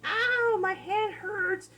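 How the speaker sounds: high yelped ow, then slow whine; mock pained yelp. Burned hand on the hot pot, a mock yelp. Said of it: "and you're like".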